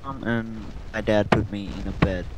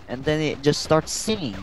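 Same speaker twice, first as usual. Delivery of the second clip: usual speaking voice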